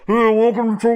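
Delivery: loud, high garbled intercom gibberish; garbled drive-thru speaker. Retold or imitated. Drive-thru worker greeting a customer over the intercom, garbled and loud. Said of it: "they'll just be like".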